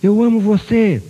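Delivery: loud, slow, high call across the valley; shouted to the mountain. Acted. Father shouting to the mountain for his son, loving loud call. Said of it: "à montanha e gritou".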